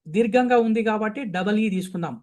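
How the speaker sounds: ordinary talk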